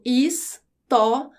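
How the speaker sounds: "história" slowly in three beats, final "ria" as one; syllable by syllable. Shown as a demonstration. Teacher showing the rising diphthong, syllabified with "ria" joined. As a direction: break "história" into syllables, keeping the I and A together. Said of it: "é um exemplo de ditongo crescente".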